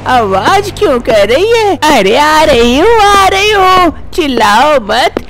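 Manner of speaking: put-on a nagging elderly amma, worried; fussy old mother-in-law. Mother-in-law answers the bahu's calls, fussing.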